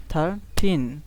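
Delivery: Thirteen split, long strong টিন; two-beat thirteen, second beat emphasized; stress on second syllable. Shown as a demonstration. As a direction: lean on the final টিন of thirteen. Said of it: "এটা একটু জোরে বলতে হবে".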